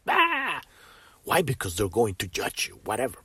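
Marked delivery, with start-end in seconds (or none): 0.1-0.6 high, drawn-out "ah" cry; mock angry outburst. Hidden angry self bursting out where society would judge it, imitated yell. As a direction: let out a quick imitated cry of anger. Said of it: "your angry self ... this part of you that just".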